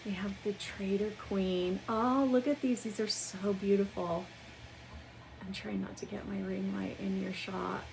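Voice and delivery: normal talking voice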